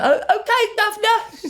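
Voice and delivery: a cartoon Brit cheerfully obeying a director in a British accent; squeaky mock British accent; exaggerated British accent. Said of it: "More British"? And she's like".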